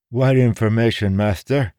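high, eager beggar voice with a lisp. A narrator's reading. Old beggar lisping eagerly at the young lord. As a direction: lisp it out eagerly, hungry for alms. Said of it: "in an eager, lisping voice".

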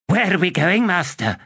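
high, bright undead servant voice; skittish skeleton. A narrator's reading. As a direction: ask eagerly like a hopping skeleton. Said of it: "Curdle asks Torrent".

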